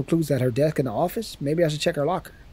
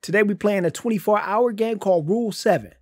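show host intro; 5 semitones higher than usual. Acted. Host greeting his buddies, big hype intro. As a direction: pump up the viewers like a show opener.

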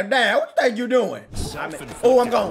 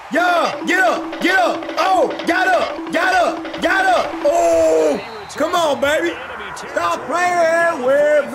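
raised, repeated hype shouts; excited urging; 4 semitones higher, slower than usual. Real, not acted. Gamer urging his man to get up, excited shouts. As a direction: holler your player onward.

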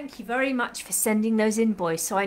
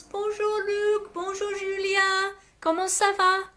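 cheery French greeting; 8 semitones higher than usual. Real, not acted.

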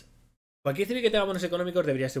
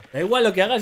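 exasperated outburst; 7 semitones higher, 8 dB louder than usual. Real, not acted. Complaining about unit sounds.

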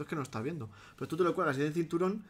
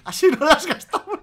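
laughing; 11 semitones higher, 12 dB louder than usual. Acted. Mocking Madrid attitude.

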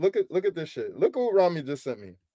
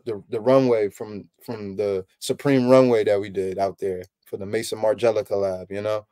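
smooth salesman pitch; 8 semitones lower, 4 dB quieter than usual. Pretend. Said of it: "a lot of people will go for that pitch".

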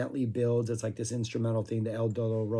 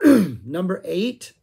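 strained loud slow rasp; losing voice; 6 semitones higher, 10 dB louder, much slower than usual. Real, not acted. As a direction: force it out with a failing voice. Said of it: "I'm losing my voice in real time".

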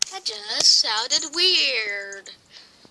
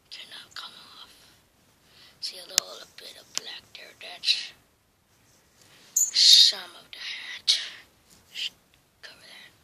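unvoiced whisper; 6 semitones lower than usual. Real, not acted. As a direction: whisper it so nobody hears. Whispering about the hidden hat.

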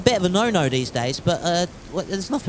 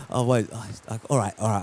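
ordinary talk, nothing marked

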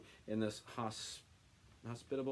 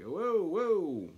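loud, rising double whoa; genuinely taken aback; shocked whoa; 8 semitones higher, 7 dB louder than usual. Real, not acted. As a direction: reader startled by the verse. Reacting to the offer of daughters.

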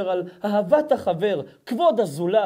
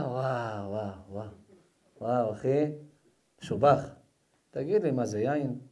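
stoned friend; 10 semitones lower, 8 dB quieter than usual. Acted. Friend who just smoked what his buddy rolled, lowered dazed voice.

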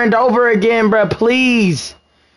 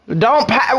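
usual speaking voice

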